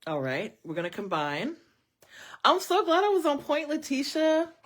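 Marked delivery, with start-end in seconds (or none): 2.4-4.5 excited delight. Real, not acted. Reader thrilled reading was right.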